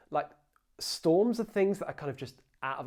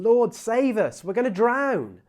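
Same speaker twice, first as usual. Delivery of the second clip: loud, higher, frantic cry for help; panicked plea; 8 semitones higher, 12 dB louder, slower than usual. Acted. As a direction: shout it like men about to drown. Disciples panic in the storm.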